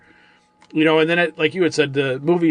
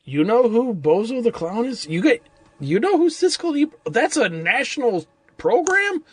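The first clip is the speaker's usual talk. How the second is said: surprised; 7 semitones higher than usual. Retold or imitated. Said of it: "and you're like".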